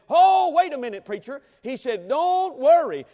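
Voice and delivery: put-on Boy Scout calling out to the preacher, elated; elated shout. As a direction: burst out with sudden glee. With new elation, scout jumped up.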